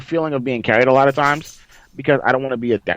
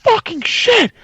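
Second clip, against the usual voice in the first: very high, strained victory scream; retold scream; 4 dB louder than usual. Player screams after winning the gunfight, high scream. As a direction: re-enact a scream of hyped disbelief. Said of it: "And I just screamed".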